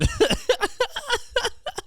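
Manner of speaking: high squealing burst of laughter; high wheezy laugh; real laugh. Real, not acted. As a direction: crack up at your own joke. Laughing at own bit.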